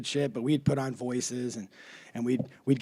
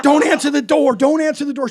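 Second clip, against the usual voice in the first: high, loud mock panic; panicked plea; 10 semitones higher, 11 dB louder than usual. Kids begging mom as the cops close in, panicked. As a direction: blurt it twice like frantic kids.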